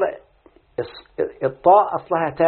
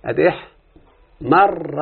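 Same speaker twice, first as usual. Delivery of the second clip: very slow, احمر stretched with the doubled ر held; slow careful demo; much slower than usual. Shown as a demonstration. Teacher demonstrating احمر slowly to his student. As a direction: draw out ادي احمر for the listener. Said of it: "شوف انتبه".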